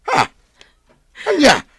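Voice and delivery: sharp high startled cry of what; stunned father cries out; shocked. Acted. Father hears of the theft.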